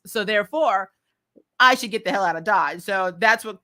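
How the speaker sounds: ordinary talk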